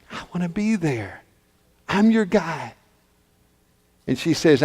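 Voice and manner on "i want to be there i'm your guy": dropped to a soft, sincere promise; tender reassurance. Acted. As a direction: reassure her softly after the outburst. [name] softens into reassurance.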